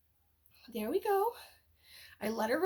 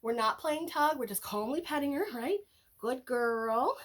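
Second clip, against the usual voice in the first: ordinary talk, nothing marked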